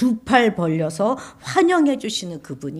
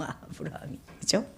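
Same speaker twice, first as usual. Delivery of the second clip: normal talking voice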